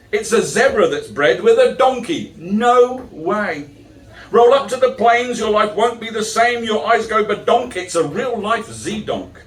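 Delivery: rhythmic, sing-song verse delivery; comic verse read to a crowd; poem recitation. Acted. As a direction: recite it like a stand-up poet. Performs the zoo poem.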